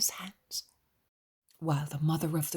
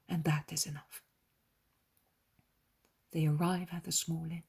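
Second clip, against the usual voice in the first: usual speaking voice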